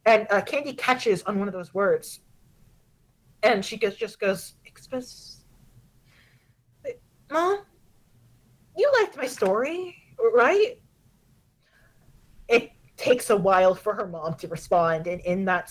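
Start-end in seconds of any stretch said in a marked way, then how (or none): 4.6-10.8 starts in a whisper, then climbs high to plead; a kid begging to hear her story was liked; hushed, then a high, hopeful question to Mom; anxious daughter. Acted.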